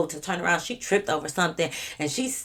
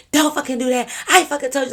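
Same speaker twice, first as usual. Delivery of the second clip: put-on higher, louder drunk outburst; mock furious yell; 8 semitones higher, 5 dB louder than usual. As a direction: yell it like she's lost it. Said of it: "She's like".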